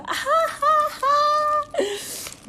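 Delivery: loud, high burst of laughter; a sudden loud peal of real laughter; big laugh. Real, not acted. Asked whether she'll cry fighting with Kak Iki, a loud high real laugh.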